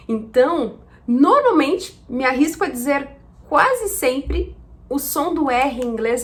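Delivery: usual speaking voice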